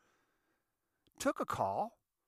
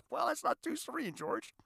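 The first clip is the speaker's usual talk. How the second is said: laughing; 12 semitones higher than usual. Real, not acted. Laughing comment.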